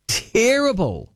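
slow, high, stretched-out "Terrible." with mock disgust; drawn-out disdain. Real, not acted. Radio host dismissing Mary Poppins, drawn-out scorn.

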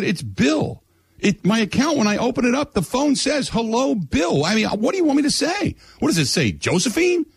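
high-pitched, incredulous retelling; exasperated protest. Retold or imitated. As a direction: argue the obvious, voice climbing in disbelief. Retold quarrel with phone agent.

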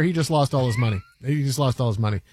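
very low, deadpan aside; evil banker. Acted. Banker tells assistant of client's loss, deadpan. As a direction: drop deep and matter-of-fact.

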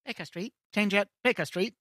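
London Tube announcement. Retold or imitated. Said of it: "I'm like".

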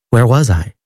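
mildly puzzled question; a touch of bewilderment, understated. A narrator's reading. Mother coming round asks her sons, mildly puzzled. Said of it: "asked in a puzzled voice".

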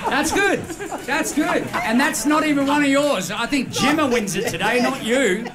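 delighted after the punchline; amused praise. Real, not acted. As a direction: gush about the joke. Praising joke, still amused.

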